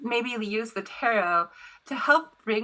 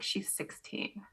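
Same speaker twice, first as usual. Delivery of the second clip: grim hushed reveal; 12 dB quieter, slower than usual. Retold or imitated. Kid's grim reveal, retold.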